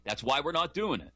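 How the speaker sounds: usual speaking voice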